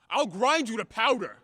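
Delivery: loud, roaring threat; furious threat. Acted. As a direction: blast him with rage.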